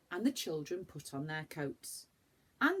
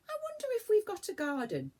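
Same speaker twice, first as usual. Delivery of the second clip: put-on much higher, faster child voice; high quick little girl, hopeful; 4 dB louder, faster than usual. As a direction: wonder aloud like an eager girl. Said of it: "said Franny".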